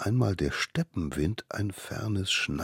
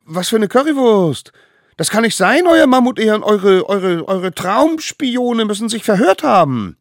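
put-on high, loud eager kids' voice; little wild ones; 11 dB louder than usual. A narrator's reading. Little wild ones insisting to the judges, high and loud. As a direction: talk fast to convince.

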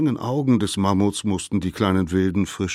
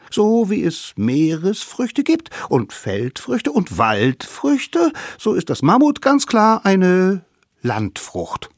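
high, loud little know-it-all; lecturing littlest savage; 12 semitones higher, 6 dB louder than usual. A narrator's reading. Littlest savage proves mammoth is fruit, lecturing eagerly. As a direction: list the proof eagerly, up high.

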